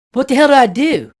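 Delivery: high, loud, desperate shout; a hint of a trapped guy calling for help; panicked shout.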